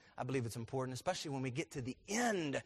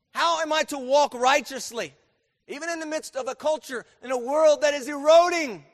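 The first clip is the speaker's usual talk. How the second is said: raised, high, loud preaching; preaching at full force; 12 semitones higher, 13 dB louder than usual. Real, not acted. Preacher pressing the congregation on righteous living, full force.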